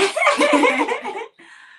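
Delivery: sudden high-pitched laugh; high burst of laughter. Real, not acted. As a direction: laugh out loud, high and bright. Reacts to balloons joke.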